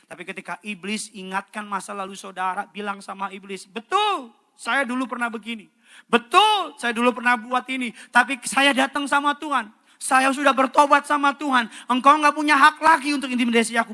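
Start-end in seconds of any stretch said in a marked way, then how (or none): 3.7-13.9 loud, high, bold rebuke; triumphant defiance; defiant declaration. Pretend. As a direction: stand up to the devil. Telling the devil off.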